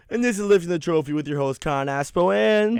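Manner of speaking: big on-air welcome back; radio show intro. Real, not acted.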